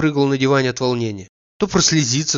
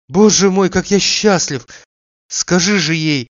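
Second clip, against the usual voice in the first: higher, louder burst of happiness; joyful outburst; 6 semitones higher, 5 dB louder than usual. A narrator's reading. Oblomov overjoyed at his friend's news, bursting with joy. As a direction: let the joy break out, slow on the last words. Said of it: "сдержав восторг".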